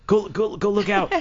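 high, drawn-out mock urging; urgent urging. Investigators sending their colleague outside, high urgent imitation. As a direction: send him off urgently, pitched high.

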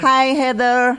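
loud, high, drawn-out hello; host beaming at her co-host as she greets her; delighted greeting. Real, not acted. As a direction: call out the name with joy.

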